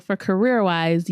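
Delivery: normal talking voice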